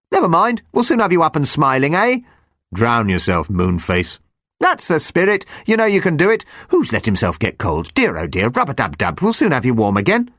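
high, bouncy little chirp; shrill chirpy voice. Acted. Jumper talks to himself while hopping, shrill and chirpy. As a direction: chirp it like a cheerful sprite hopping along.

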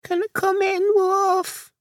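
high, thin little lamb plea; lamb crying out. A narrator's reading. Lamb asking to be let in, crying out high. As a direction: plead in a tiny high voice. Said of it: "she cried".

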